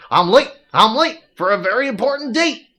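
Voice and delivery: hurried rabbit-like fuss; rushing character. Acted. Voices a hurried NPC.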